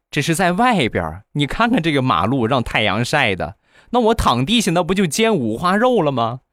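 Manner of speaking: a lightly voiced aggrieved husband pleading with his angry girlfriend. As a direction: wheedle her with a self-pitying plea. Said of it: "她老公很委屈的就说".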